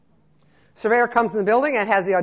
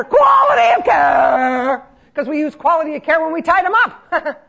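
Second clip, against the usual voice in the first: mock emphasis; 8 semitones higher, 10 dB louder, slower than usual. Mocking euphemism about restraints.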